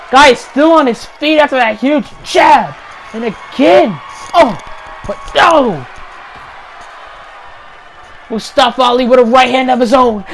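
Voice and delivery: usual speaking voice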